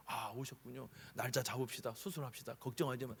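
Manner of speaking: soft, low comforting voice; calm the patient down gently; doctor quietly reassuring a patient. Retold or imitated. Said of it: "위로하고는".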